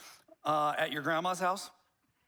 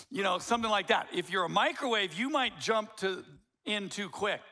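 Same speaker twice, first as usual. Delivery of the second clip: normal talking voice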